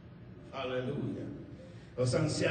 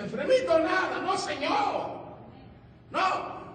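high, emphatic scoffing refusal; indignant rebuttal; 11 semitones higher, 3 dB louder, slower than usual. Acted. Preacher rejecting the excuse that he is sick, indignant. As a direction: throw the excuse back hard.

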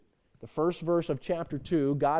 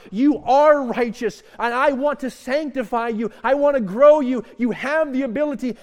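higher, louder passionate preaching; fervent preaching voice; preaching at full force; 6 semitones higher, 9 dB louder than usual. Acted. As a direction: lift the room with promise.

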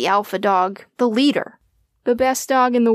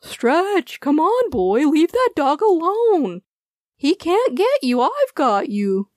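gentle, slowing owner coaxing his dog; an owner pulling his dog away gently; reassuring; 7 semitones higher, slower than usual. A narrator's reading. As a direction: coax him away kindly. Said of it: "continued to remind his beloved friend".

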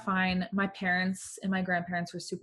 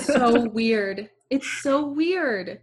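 amused; 4 semitones higher, 5 dB louder, slower than usual. Real, not acted. Amused agreement, drawn out.

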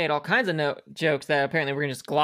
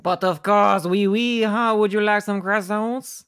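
higher, louder put-on French accent; 5 semitones higher, 4 dB louder, slower than usual. Shown as a demonstration. Co-host asks for a full sentence, performed French accent. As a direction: play an eager cartoon Frenchman, pitched up. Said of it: "Give me a full sentence in a French accent".

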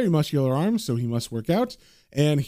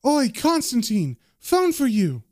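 mild yell; 7 semitones higher, slower than usual. A narrator's reading. Bartender calling John to the phone, mild yell. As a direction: call across the bar, not full volume. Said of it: "the bartender yells to John".

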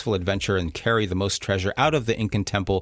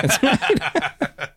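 laughing. Real, not acted. Laughing at own blackjack mistake.

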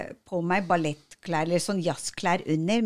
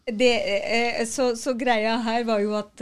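ordinary talk, nothing marked

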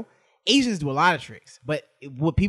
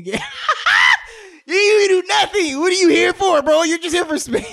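high, loud half-laughing rant; laughing shout; 14 semitones higher, 8 dB louder than usual. Acted. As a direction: shriek it while cracking up. Ranting at other man, laughing.

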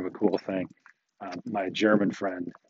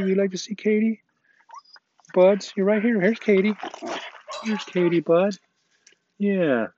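higher, sing-song pet voice to the dog; fond, higher voice for the dogs meeting at the gate; doggy talk; 7 semitones higher, 3 dB louder than usual. Real, not acted. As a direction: coo at the dog like greeting a puppy. Talking to his dog on walk.

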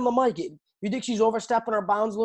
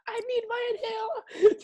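mocking whiny fighter; 3 dB quieter than usual.

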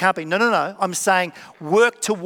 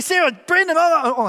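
much higher, startled stammer of oh; sudden realisation; 10 semitones higher, 4 dB louder than usual. Retold or imitated. Pastor realises he's gossiping to Sarah, startled higher catch. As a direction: catch yourself mid-gossip, alarmed.

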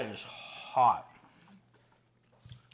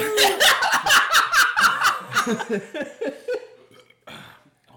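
loud laughing fit; 10 dB louder than usual. Real, not acted. Laughs hard through hot sauce pain.

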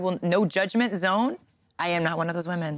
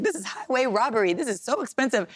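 retold grumbling; 6 semitones higher than usual. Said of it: "grumbling about".

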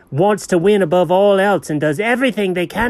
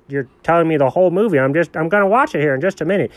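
ordinary talk

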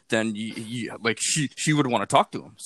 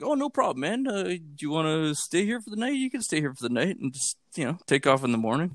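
friendly offer; 7 semitones higher than usual. Retold or imitated. Voicing themselves, friendly offer.

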